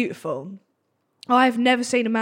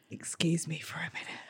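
imitated strained whisper; barely voiced polite exit; discreet whisper; 7 semitones lower, 17 dB quieter than usual. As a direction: excuse yourself under your breath. Man discreetly flees the table.

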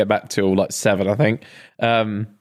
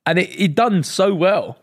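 emphatic; 6 semitones higher, slower than usual. Real, not acted. Insisting, higher and slow.

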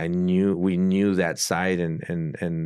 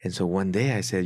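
usual speaking voice

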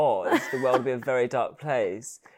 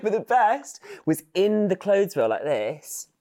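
ordinary delivery

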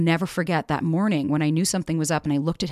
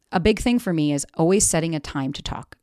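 usual speaking voice

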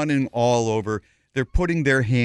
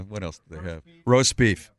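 normal talking voice

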